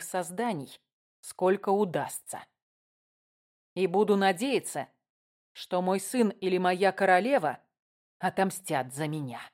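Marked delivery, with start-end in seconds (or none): none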